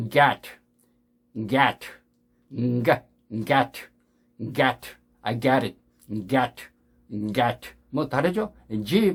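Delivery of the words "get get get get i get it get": "get" with a voiced hard g and open vowel, near "gat"; American pronunciation of get. Shown as a demonstration. Teacher showing real American sound, voiced get. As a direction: start "get" on a hummed, voiced g. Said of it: "실제로 미국 사람의 발음을 들어보십시오".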